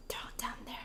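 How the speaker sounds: eerie whispered reveal; spooky whisper to his wife. Retold or imitated. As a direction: breathe it out like a ghost story. Ominous reveal at basement door.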